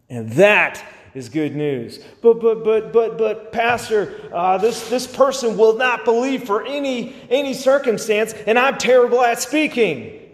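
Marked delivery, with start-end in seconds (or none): 2.2-10.2 high, anxious objection to the pastor; flustered, pleading doubter; stammered protest. Acted. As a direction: sputter excuses in a raised pitch. Acts out a protesting church member.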